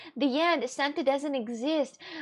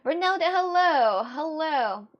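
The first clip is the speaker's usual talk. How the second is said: much louder, higher hello to Ronaldo; loud greeting; 5 semitones higher, 6 dB louder, slower than usual. Real, not acted. Streamer hails Ronaldo in chat with a loud call. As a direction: call out warmly to the chatter.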